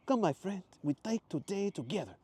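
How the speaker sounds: excited tourist in a put-on accent; Mateo's accent. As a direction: put on Mateo's foreign lilt.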